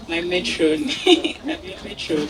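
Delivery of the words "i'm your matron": laughing. Real, not acted. Teasing friend, laughing.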